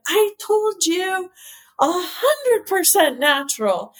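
high, slow mock German accent scolding. Horst throwing a bottle at the chemist, mock German accent. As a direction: do the German founder scolding her in a hammed-up accent. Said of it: "like, a German accent".

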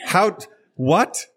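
high, slow, incredulous what; can't believe what he heard; stunned disbelief. Real, not acted. Reacting to being called interrupter.

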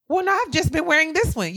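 high-pitched mimicked mom; imitated motherly protest; defensive mother. Mimics mother's reply about coat.

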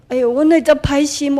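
loud dismayed self-scolding; self-reproach. Retold or imitated. Speaker scolding herself for an evil thought, loud and dismayed. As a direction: catch yourself thinking something cruel.

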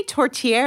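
"tourtière" as tor-tee-AIR, with a sounded final r; Americanized pronunciation. Shown as a demonstration. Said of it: "that's obviously an American".

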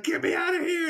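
high-pitched imitation of a frantic victim; mock screaming. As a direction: squeal it like a trapped girl. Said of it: "the girl screaming".

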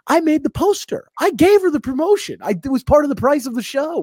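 high-pitched, exasperated protest; squeaky comic outrage; indignant. Real, not acted. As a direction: let the voice shoot up in disbelief. Protests the owner's promotion cost claim.

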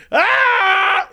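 high mock scream of panic; hammed-up high scream; panicked scream. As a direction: shriek like a scared driver. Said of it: "He's like".

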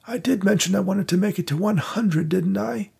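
wry and a little faint after the fright; a lightly voiced old man recovering from a scare; shaken old manager. Victor shaken by Jennifer's scream.

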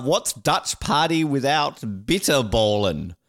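ordinary talk

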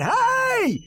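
very high, loud yell; yelling again at the prosecutor; shout. Acted. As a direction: bellow it out. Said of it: "I yell again".

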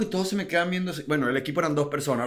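ordinary talk

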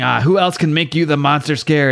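usual speaking voice

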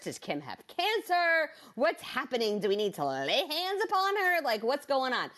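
high-pitched, panicky string of questions; mock alarm. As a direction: play the worried pastor jumping to conclusions. Imagined pastor's alarmed questions.

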